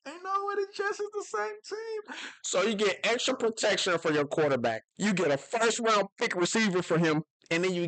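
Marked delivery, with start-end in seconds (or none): 0.1-2.2 high, soft, squeaky disbelief; can't believe it, half laughing; high incredulous aside. Real, not acted.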